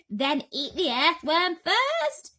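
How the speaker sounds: high, loud, slow shouted plea. Acted. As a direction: yell it at the cloud man. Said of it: "shouted the centipede".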